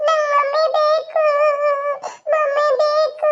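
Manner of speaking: put-on high, slow sobbing little boy's voice; crying child. Boy crying to his father that he wants mummy, high sobbing child voice. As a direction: cry it out like a small child who lost his mother.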